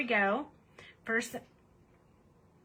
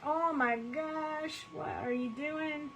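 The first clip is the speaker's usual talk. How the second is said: slow, higher-pitched mock alarm from a friend; imitating Jamie; 6 semitones higher, 3 dB quieter, slower than usual. Mocking how Jamie would react to the mess. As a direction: stretch it out like a shocked friend. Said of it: "She'd be like".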